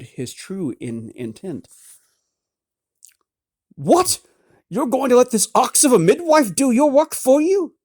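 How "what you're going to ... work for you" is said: high, loud indignant protest; outraged noble. A narrator's reading. Noble lady outraged a midwife will sew her. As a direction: protest in scandalised disbelief.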